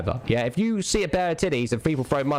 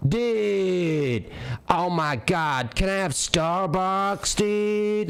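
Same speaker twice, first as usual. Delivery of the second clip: high, slow, drawn-out dude voice; mocking Z-Laner voice; 8 semitones higher, much slower than usual. Streamer mocks another streamer's dude voice. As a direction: stretch it out like a whiny bro asking for a drink. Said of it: "there's no way he goes".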